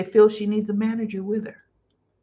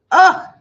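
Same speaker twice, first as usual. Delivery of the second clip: sharp high gasp of delight; delighted cry; 8 semitones higher, 9 dB louder than usual. Real, not acted. Host overwhelmed by the scene, cries out in delight. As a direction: let out a loud cry of joy. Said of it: "Whew, that was so good".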